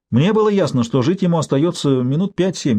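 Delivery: ordinary delivery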